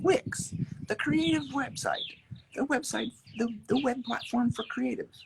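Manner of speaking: very high announcer pitch; hammed-up high tagline read; mock ad voice. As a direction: sell it like an ad.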